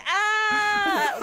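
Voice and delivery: high shouted cat cry; cat sound. Pretend. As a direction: yowl like a cat. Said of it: "Cats sound like".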